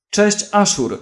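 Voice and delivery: a hint of a sly female greeting; a woman greeting him from behind with a gun; woman's voice. A narrator's reading. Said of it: "usłyszeli kobiecy głos".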